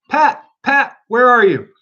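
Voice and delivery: high, loud angry shout; angry call. A narrator's reading. Rabbit shouting angrily for his gardener Pat. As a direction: yell for Pat in a temper. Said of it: "Next came an angry voice".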